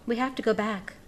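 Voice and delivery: plain reading voice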